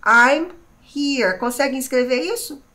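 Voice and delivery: normal talking voice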